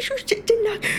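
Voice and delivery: usual speaking voice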